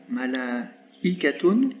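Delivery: malaikatun drawn out very slowly, syllable by syllable; slow syllable demo. Shown as a demonstration. Teacher slowing a word so students hear the hamza, slowly. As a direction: spell out malaikatun slowly with the ear. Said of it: "précédé d'un A long".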